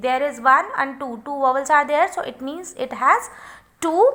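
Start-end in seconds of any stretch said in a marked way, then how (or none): none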